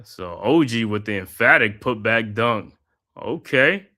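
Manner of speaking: steady broadcaster's call of the action; live basketball play-by-play commentary. Acted. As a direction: call the game like a courtside announcer. Calls the game play by play.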